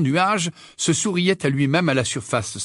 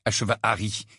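usual speaking voice

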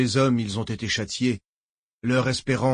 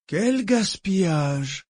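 slow, higher-pitched reflective remark; musing; 8 semitones higher, much slower than usual. A narrator's reading. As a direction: draw it out, lost in thought. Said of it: "d'une voix songeuse". (songeur, lent)

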